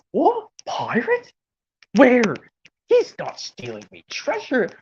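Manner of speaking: high, startled pirate voice; Captain Scallywag. Acted. Pirate captain guarding his treasure from another pirate, startled. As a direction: squawk in pirate alarm. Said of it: "I'm Captain Scallywag".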